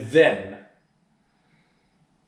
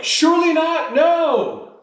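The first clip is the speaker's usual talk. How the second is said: high, loud cry of disbelief; the whole crowd refusing to believe it; a loud, high-pitched no way; shocked protest; 7 dB louder, slower than usual. Retold or imitated. Disciples protesting Jesus' prediction.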